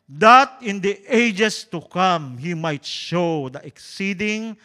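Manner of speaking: loud, slow preaching read; scripture reading. Acted. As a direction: proclaim the verse with weight. Reads Bible verse aloud. (mabagal at malakas na pagbasa ng Kasulatan)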